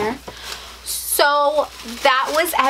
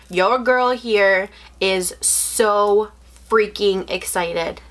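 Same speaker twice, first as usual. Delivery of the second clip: ordinary delivery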